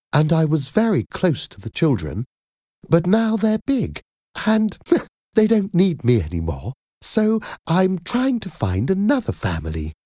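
high-pitched chatty nanny with a little chuckle; friendly, strong. A narrator's reading. Nanny explaining why she seeks a family, friendly and strong. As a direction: talk friendly and confident, pitch well up. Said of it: "was friendly and strong".

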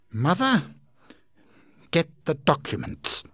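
usual speaking voice

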